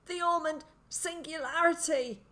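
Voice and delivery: high, loud, strained utterance; gasping. A narrator's reading. Pinned man warns boy, gasping for breath. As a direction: force it out between gasps. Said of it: "he said between gasps of breath".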